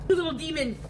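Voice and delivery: awed whisper at the cat; whispered amazement. Real, not acted. Cat squeezes into a gap.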